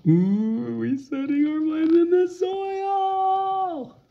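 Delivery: very high, bright playful questioning voice; excited sing-song. Acted. Parent cheering on a child reading aloud, very high and excited. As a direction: gush with exaggerated delight at the child's reading.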